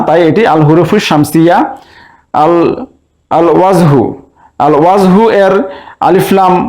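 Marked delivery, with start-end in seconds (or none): 3.3-5.2 "আল ওয়াজহু" with the article's lam audible; lam pronounced. Shown as a demonstration. Teacher repeating for students, lam sounded in "আল ওয়াজহু". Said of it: "উচ্চারণ হচ্ছে".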